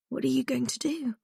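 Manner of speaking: high, soft anxious whisper; frightened hushed question. A narrator's reading. Prisoner asks Holly as guards approach, frightened. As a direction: ask it in hushed panic.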